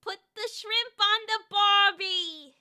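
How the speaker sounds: slow put-on Australian accent; a slow hammed-up Australian accent. Streamer playing an Aussie character, slow mock Australian accent. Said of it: "I'm an Aussie".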